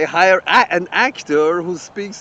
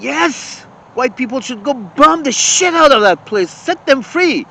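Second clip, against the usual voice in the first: higher, louder mocking cheer; mock hawkish guest; 7 semitones higher, 5 dB louder than usual. Mocking Bill Maher's guests as eager warmongers. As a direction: ham up a gung-ho bomb-them voice.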